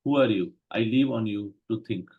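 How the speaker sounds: usual speaking voice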